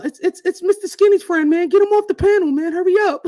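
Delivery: high, loud squeaky panicked stammer; mock frantic squeal; mocking friend's panic. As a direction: imitate a friend begging to get him off. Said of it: "told him".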